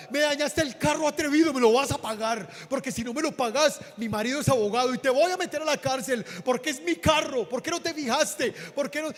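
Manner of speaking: screaming at the man who scratched her car; angry woman shrieking at the driver; furious shout. Retold or imitated. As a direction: yell it out, high and loud, as the enraged driver. Furious lady yelling in story.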